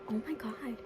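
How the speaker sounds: a breathy, choked whisper; tearful whisper. Real, not acted. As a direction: whisper it on the edge of tears. Overwhelmed by the ending.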